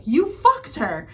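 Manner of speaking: high, loud, drawn-out accusation; shocked accusation. Retold or imitated. Retelling Mia confronting her partner, shocked accusation.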